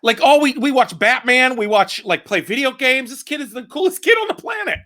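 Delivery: high-pitched, excited gushing. Real, not acted. Comedian gushing to co-host about his nine-year-old son, excited. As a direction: rave with pitch climbing high.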